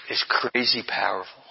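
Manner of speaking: breathy whispered aside; hushed awe. Real, not acted. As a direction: lean in and whisper it.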